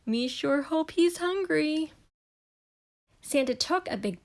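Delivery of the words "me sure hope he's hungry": higher monster voice, hopeful; Cookie Monster. A narrator's reading. Cookie Monster hopes Santa is hungry, monster voice. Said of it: "Cookie whispered".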